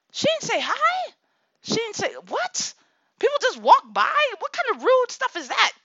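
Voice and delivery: high, outraged disbelief; mock-outraged high-pitched rant; indignant mom rant. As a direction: squeal it like an offended mother. Mom reenacts her indignant rant.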